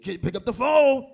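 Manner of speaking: slow, high, loud holler; yelling inmate. Acted. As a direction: bellow it down the phone line. Inmate yelling over automated call.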